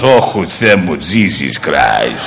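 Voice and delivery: very low, drawn-out marvel; awestruck father. Acted. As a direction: sink into a deep, slow exclamation. Father awed by the blonde.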